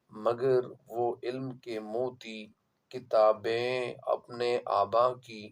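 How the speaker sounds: slow, measured verse reading with long pauses; poetry recitation. Acted. Teacher reading Iqbal's couplet to students, measured recitation. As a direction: recite the couplet slowly, leaning on the words.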